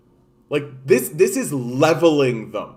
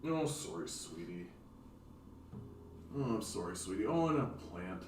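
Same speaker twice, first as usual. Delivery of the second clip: soothing; 4 semitones lower, 16 dB quieter than usual. Real, not acted. Soothes pet after accident.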